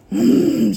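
loud pleased mmm; satisfied mm. Real, not acted. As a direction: savor it out loud. Tasting petit four.

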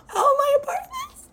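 gasp, then a very high wail of disbelief; mock tearful protest. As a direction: squeal it like you're about to cry, hammed up. Acts her distressed, baffled protest.

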